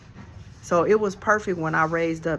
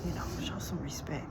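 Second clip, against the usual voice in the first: much softer, half-whispered request; hushed warning; 10 dB quieter than usual. Retold or imitated. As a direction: quietly ask him to show respect. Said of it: "the brother told him".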